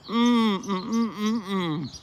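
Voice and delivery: fading mmm of pleasure while chewing; savoring mm. Real, not acted. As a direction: hum with delight over the food. Eating the orange.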